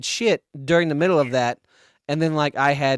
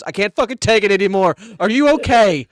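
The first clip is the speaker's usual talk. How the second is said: higher, louder, mock exasperated call; exasperated outburst; 6 semitones higher, 5 dB louder than usual. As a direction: burst out, then call over the stall. Stall neighbour cracks, retold.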